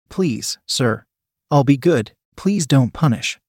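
usual speaking voice

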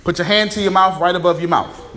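ordinary delivery

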